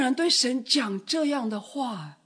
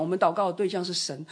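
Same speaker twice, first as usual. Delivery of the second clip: usual speaking voice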